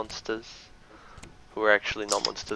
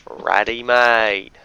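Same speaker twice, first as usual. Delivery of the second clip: drawn-out, louder broad Aussie accent; broad Australian accent; 8 dB louder, much slower than usual. Shown as a demonstration. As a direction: lay the Australian accent on thick and slow. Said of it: "I just gotta broaden my accent".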